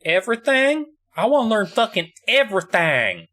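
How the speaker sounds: put-on high, keen boy's voice; eager young apprentice. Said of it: "Nathan's just like".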